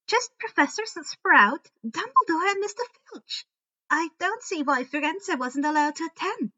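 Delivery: high, bright-eyed matter-of-fact voice; dreamy girl listing the guests; Luna informing. A narrator's reading. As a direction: set them straight sweetly and high. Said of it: "Luna informed them".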